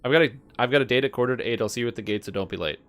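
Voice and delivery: rattled off quickly; said fast. Shown as a demonstration. Streamer proving the gate rhyme is easy, rattling it off fast. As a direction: race through it. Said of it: "That one's not hard".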